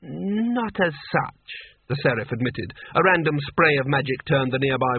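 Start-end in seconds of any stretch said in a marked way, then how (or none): 0.0-1.5 slow, drawn-out tipsy admission; drunk sultan voice. A narrator's reading. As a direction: stretch it out sheepishly.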